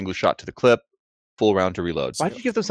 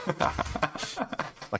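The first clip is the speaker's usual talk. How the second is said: ordinary talk, nothing marked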